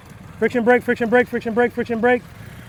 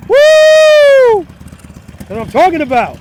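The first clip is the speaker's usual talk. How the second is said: excited cheer; 12 semitones higher, 9 dB louder, much slower than usual. Real, not acted. Rider finally gets it right.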